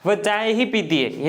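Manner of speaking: ही pressed hard after चाय; चाय ही with ही weighted and falling; stress on ही. Shown as a demonstration. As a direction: lean on ही, let the rest fall. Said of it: "हमने object पर जोर या दबाव दिया".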